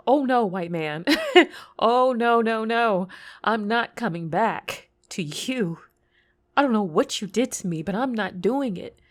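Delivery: hammed-up flat-out refusal with a yelp; emphatic refusal. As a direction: dig your heels in, loudly. Acted refusal to return.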